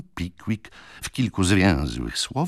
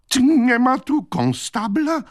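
high, slow, anxious cry for a constable; anxious Snodgrass; 4 dB louder, slower than usual. Acted. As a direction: call out for help, worried and shrill-free but high. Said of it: "zapytał pan Snodgrass". (wysoki, przeciągły, zaniepokojony okrzyk)

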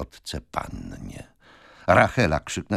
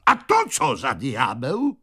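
raised, slow, startled-sounding outburst; violent shout; 6 semitones higher, slower than usual. A narrator's reading. Man demands who this stranger is, violent cry. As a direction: cry out sharply, pitch up. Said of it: "krzyknął pan Jingle głosem tak gwałtownym".

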